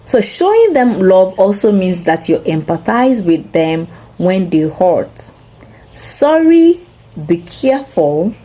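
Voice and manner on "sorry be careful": high, gentle sympathetic sorry; caring sorry. Shown as a demonstration. Parent comforting a hurt child, caring and sympathetic. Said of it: "is different from".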